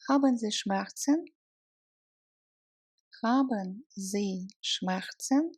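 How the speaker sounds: usual speaking voice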